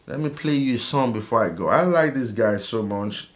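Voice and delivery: usual speaking voice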